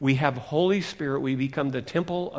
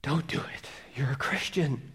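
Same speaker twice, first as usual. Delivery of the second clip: soft whispered plea; quiet, half-whispered inner appeal; pleading voice; 7 dB quieter than usual. Acted. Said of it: "you hear the pleading voice".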